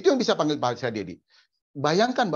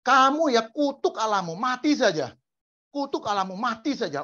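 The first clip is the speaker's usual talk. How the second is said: high, shrill-pitched mock wife scolding; wife mocking Job, high and pushy; nagging wife; 10 semitones higher than usual. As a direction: play the wife taunting him, pitched way up. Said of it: "waktu istrinya ngomong".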